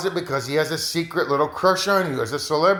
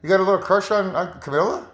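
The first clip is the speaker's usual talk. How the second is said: put-on jealous girlfriend; 6 semitones higher, faster than usual. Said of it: "a conversation like that with your girlfriend".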